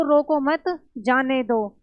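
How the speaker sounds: "रोको मत" as one unit, break, then "जाने दो"; pause after मत. Shown as a demonstration. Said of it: "इसमें बोला गया है कि".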